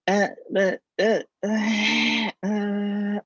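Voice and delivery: high, flustered uh-uh stammer; panicked stammer. Retold or imitated. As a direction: stammer like a cornered employee. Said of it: "So I'll give you an example".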